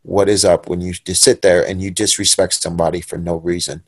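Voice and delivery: low, quiet retold menace; anger held down, soft and low; simmering anger. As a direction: vow payback under your breath. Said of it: "the anger is boiling up in me".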